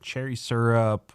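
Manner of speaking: usual speaking voice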